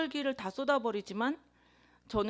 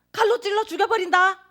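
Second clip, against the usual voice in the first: 칼로 shouted high and loud as a threat; quarrelling with her sister, a loud high furious threat; retold furious yell; 9 semitones higher, 10 dB louder than usual. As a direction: yell the threat with a hard hit on 칼로. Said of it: "이렇게 말한 거예요".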